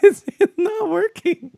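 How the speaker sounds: high-pitched giggly laughter through the words; laughing helplessly. Real, not acted. Streamer giggling at a broken config window, high-pitched laughing.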